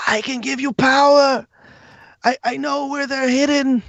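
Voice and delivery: high, pleading monster voice; pathetic creature. Acted. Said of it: "very pathetically whispers".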